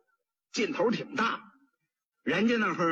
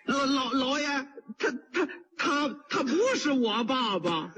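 stammering fear; 5 semitones higher than usual. Acted. Pot seller denying his father, stammering and tearful. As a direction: stutter it out, half crying.

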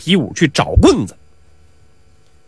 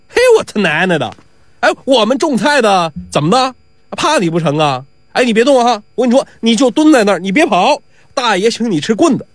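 put-on impatient young watchman, pitched up; higher-pitched, blustering challenge; hot-tempered outburst; 5 semitones higher than usual. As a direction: lose patience and threaten him. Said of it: "看菜这小伙子性急了".

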